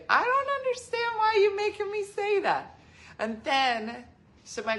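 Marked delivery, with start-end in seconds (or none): 0.1-2.8 put-on very high, loud old lady whine; imitating grandmom protesting; grandma voice. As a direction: whine it like a confused little grandmother. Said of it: "and she'd say".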